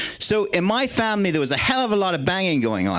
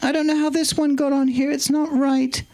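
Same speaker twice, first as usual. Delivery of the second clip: the Queen's voice; 10 semitones higher than usual. Retold or imitated. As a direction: stay in the high Queen impression while reading the menu. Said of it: "the Queen's voice".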